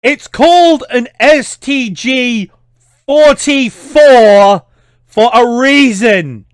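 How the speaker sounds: high, loud and slow, spelling it out in fury; exasperated gamer shouting at the developers; outraged rant. Real, not acted. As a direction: hammer each word home in disbelief. Ranting at game's wrong gun.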